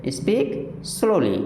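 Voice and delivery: very slow, every sound stretched for the class to copy; teacher dictating a phrase, very slow; drawn out slowly. Shown as a demonstration. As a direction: say speak slowly slowly, syllable by syllable. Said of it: "क्या बोलेंगे".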